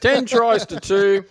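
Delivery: talking with cake in mouth; chewing while talking; mouth full. Real, not acted. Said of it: "and I got cake in my mouth".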